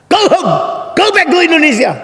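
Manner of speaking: high, loud heckler's yell; shouting to chase away. Retold or imitated. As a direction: imitate a furious heckler driving someone off. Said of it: "Dia teriak-teriak mengusir".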